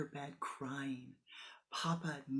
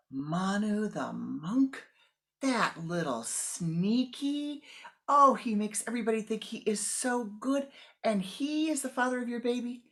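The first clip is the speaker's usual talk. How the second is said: outraged father; 7 semitones higher, 8 dB louder than usual. Acted. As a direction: rage at the sneaky monk.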